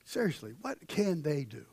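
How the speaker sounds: low, soft rhetorical question; subdued preacher's aside; hushed aside. Real, not acted. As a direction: drop the voice and ask it quietly. Preacher drops after climax.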